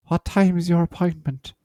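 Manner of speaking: put-on high, frail old woman; a creaky old lady asking. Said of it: "I'm gonna do an old lady voice".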